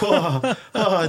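laughing whoa. Retold or imitated. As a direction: let it out with a laugh of relief. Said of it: "I was like".

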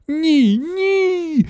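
very high, drawn-out yelp; wailing. A narrator's reading. Wonka grabbed by grandmother, high panicked wail. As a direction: cry out at the top of your pitch. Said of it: "заволав містер Вонка".

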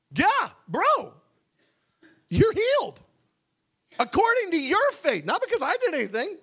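loud, high, laid-back bro voice; casual Paul. Acted. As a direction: play Paul as a chill dude telling him off. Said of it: "he says".

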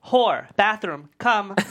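loud, slow summons across the apartment; calling out. Acted. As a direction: drag each word out as you yell for her. [name] calls Amy to bathroom.

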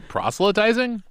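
"Proselytizing" as PROSS-luh-tizing, high rising question; laugh, then query "Proselytizing" with PROSS up front, pitched high; questioning correction. Shown as a demonstration. Host querying a friend's word, "Proselytizing" as a high PROSS-luh-tizing?. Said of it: "I don't know how they pronounce it".

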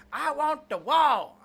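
slow, loud, high southern drawl on "I want the wall."; mock southern president voice; southern accent. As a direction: ham up a southern twang, stretched out. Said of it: "I gave him a southern accent".

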